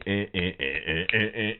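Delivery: normal talking voice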